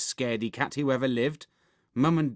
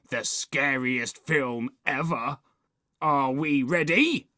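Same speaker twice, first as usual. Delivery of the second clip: hyping up; 3 semitones higher, 4 dB louder than usual. A narrator's reading. Boy hypes film to friends.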